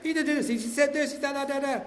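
high-pitched mock complaining; acted-out rant. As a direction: rattle off grievances, imitating a rant. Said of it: "she just let me rant".

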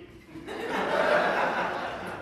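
a breathy, high burst of laughter. Real, not acted. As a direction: break into laughter. Laughing after son's greeting.

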